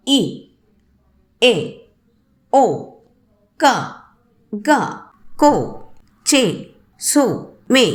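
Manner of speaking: ఈ, ఏ, ఓ, క, గ, కో, చే, సో, మే each in one quick beat, with pauses between; quick single syllables. Shown as a demonstration. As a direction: pop out ఈ, ఏ, ఓ, క, గ, కో, చే, సో, మే one at a time. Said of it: "ఒకే శ్వాసలో టక్కున పలికేటువంటి". (ఈ, ఏ, ఓ, క, గ, కో, చే, సో, మే ఒక్కొక్కటి టక్కున పలకడం)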